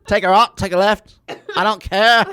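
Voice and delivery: loud, high Scottish brogue of Gary John Bishop. Retold or imitated. Friend mimics Gary John Bishop for a laughing co-host, in a loud Scottish brogue. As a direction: belt it out in a hammed-up Scottish brogue. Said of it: "that Scottish brogue".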